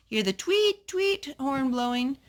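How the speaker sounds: tweet. Acted. Train whistle imitated in reading.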